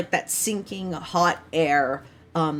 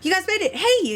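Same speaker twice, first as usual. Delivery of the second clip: much higher, louder happy call; excited greeting; 14 semitones higher, 4 dB louder than usual. Acted. Kat calls joyfully to the party arriving on the beach. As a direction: call out bright to friends arriving. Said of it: "She's like".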